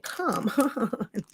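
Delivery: strained, laughing under breath; straining at a stuck lid. Real, not acted. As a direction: laugh while forcing a lid. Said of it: "let's just take this off".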